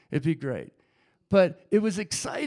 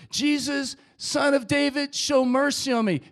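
loud, raised plea for mercy; screaming out; 4 semitones higher, 5 dB louder than usual. Acted. Blind beggar pleading past those hushing him, loud cry. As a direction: call out firmly over the crowd. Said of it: "screamed out even louder".

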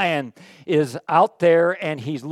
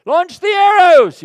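high, slow, booming battle order; commander's shout; 13 semitones higher, 13 dB louder, slower than usual. Acted. Enemy general orders his archers, booming shout. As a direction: bellow the command across the battlefield.

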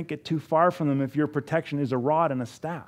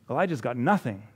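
ordinary talk, nothing marked

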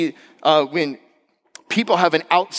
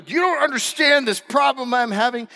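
high, whiny mock protest; frustrated protest; 9 semitones higher than usual. Frustrated man protesting to his helper, mock exasperated. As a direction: plead it with comic frustration.

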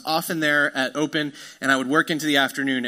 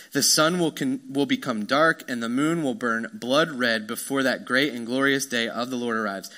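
scripture reading; 3 semitones lower than usual. Acted. Pastor reading Peter's sermon aloud, solemn.

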